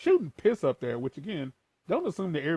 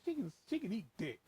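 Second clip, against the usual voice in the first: blunt real voice; 5 semitones higher, 9 dB quieter than usual. Retold or imitated. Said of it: "I said it in my real voice".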